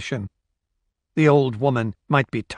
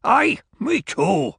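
put-on slow high growl of a grumpy old man; growled reply; 11 semitones higher, slower than usual. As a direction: growl it like a sour old Scot. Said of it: "came the growled reply".

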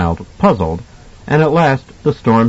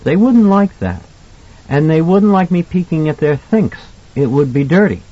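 put-on high, earnest child voice; a boy explaining what's not allowed, pitched up; little boy Danny, earnest; 7 semitones higher than usual. A narrator's reading.

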